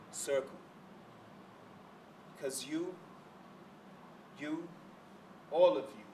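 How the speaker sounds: slow right down, phrase by phrase, hushed; spoken-word poem ending. Acted. Poet closing to the audience, hushed and halting spoken word.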